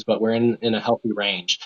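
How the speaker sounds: ordinary talk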